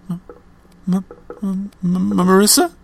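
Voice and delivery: high, stammering frightened call; scared stammer. Acted. Frightened friend calling into the silent phone, stammering. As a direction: stutter her name in fear.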